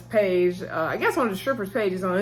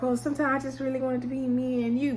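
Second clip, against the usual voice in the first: imitating her higher flirty phone voice; switched-up coy voice; 5 semitones higher, 3 dB quieter than usual. Mercedes sweet-talking the coach on the phone, mock flirty. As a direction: lift the pitch to flatter him. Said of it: "she switched her voice up real quick".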